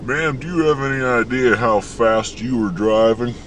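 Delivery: normal talking voice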